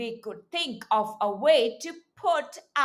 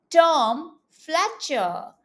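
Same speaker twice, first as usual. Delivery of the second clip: slow, clear model for repeating; slower than usual. Shown as a demonstration. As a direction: say the name slowly, word by word. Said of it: "Say it with me".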